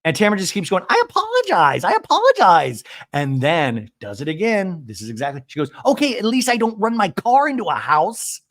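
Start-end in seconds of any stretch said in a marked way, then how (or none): 0.9-2.8 high, loud mock shriek; hammed-up shouted apology; insistent apology. Retold repeated protest, keeps going. 5.9-8.4 high-pitched mild mock yell; half-hearted high scream; retold scream. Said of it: "And she screams this".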